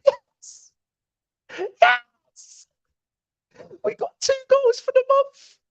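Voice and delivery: very high-pitched goal celebration; ecstatic celebration. Real, not acted. Fan cheering a striker's goal, ecstatic. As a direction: squeal it out in triumph.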